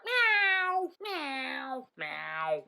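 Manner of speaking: high squeaky mewing; cat meow. Retold or imitated. Cat answering the man at the garage, squeaky meowing. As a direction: mew like a small cat. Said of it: "just turns around and is like".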